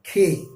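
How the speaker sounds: खे said with a breathy kh burst; aspirated K. Shown as a demonstration. Teacher showing the native aspirated K sound. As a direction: puff air out hard on खे. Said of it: "एक के और दूसरा खे".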